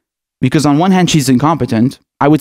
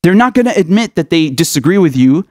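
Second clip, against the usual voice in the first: ordinary delivery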